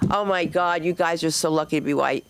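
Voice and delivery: usual speaking voice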